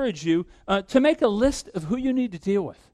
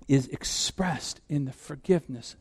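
normal talking voice

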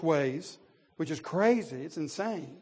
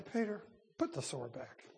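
quiet calm rebuke; 9 dB quieter than usual. Acted. Jesus calmly correcting Peter after the outburst, quiet. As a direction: gently tell Peter to put the sword back.

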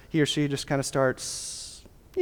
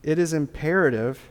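ordinary talk

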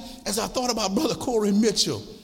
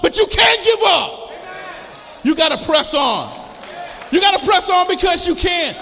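loud, rising preacher's call, much higher; full-force preaching; 5 semitones higher, 5 dB louder than usual. Acted. Pastor urging the struggling congregation to press on, shouting.

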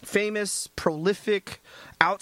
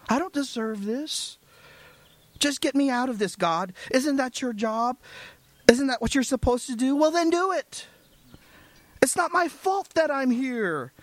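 petulant sinner; 6 semitones higher than usual. Retold or imitated. Sinner bargaining with God.

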